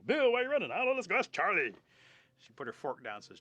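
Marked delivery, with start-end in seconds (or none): 0.1-1.7 high, very loud story voices; frantic shouted exchange. Retold or imitated. Horses questioning each other in improvised tale, frantic shouting. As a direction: holler it on the run.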